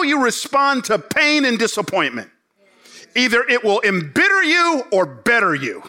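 rising sermon climax, pressing each line home; full-force preaching. Acted. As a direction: preach it hard at the congregation.

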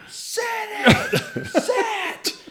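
shrieking high laughing yell; hammed-up laughing scream. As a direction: scream it at the fish. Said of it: "my thing there was just screaming".

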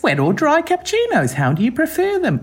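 high-pitched, bewildered mock quote of the girl; puzzled barista. The competitor thrown by the cappuccino question, high and confused. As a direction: play her baffled, voice up high. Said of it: "She was processing this question".

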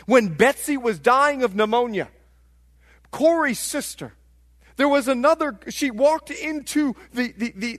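ordinary talk

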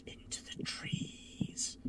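whispered narration. A narrator's reading. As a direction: breathe it out. GM narrating vanishing.

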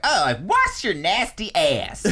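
a jokey sendup of a street accent. Shown as a demonstration.

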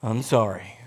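low, reluctant mock apology; sigh, then give in like a child; grudging kid. Kid pressed by parent to apologise, grudging. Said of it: "And then what do the kids do?".

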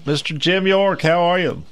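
normal talking voice

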